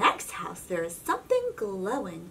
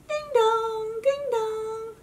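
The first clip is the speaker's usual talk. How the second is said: high sung doorbell; doorbell chime; 12 semitones higher, slower than usual. Acted. Doorbell rings.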